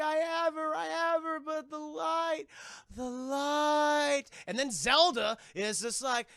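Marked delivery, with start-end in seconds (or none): 0.0-4.2 high, drawn-out cry from the portal; mother calling out. Retold or imitated. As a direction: wail it slowly as the mother. Mother calls from inside portal.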